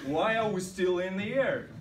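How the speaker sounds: loud, high exasperated question; out of patience. Retold or imitated. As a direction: demand it, acting completely fed up. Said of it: "I was really nervous, without any patience".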